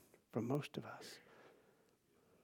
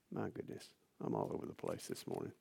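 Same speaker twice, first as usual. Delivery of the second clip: muttered aside; 16 dB quieter, faster than usual. Real, not acted.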